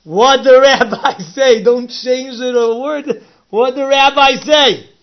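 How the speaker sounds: high, loud and slow, word by word; slow, loud, high demand not to change a word; slow loud insistence. Retold or imitated. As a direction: press him for every word. Repeats the demand more forcefully.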